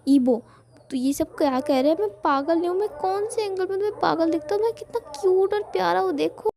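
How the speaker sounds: mock outrage at being called mad; angry protest. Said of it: "बहुत ज़्यादा गुस्से में हो जाता है".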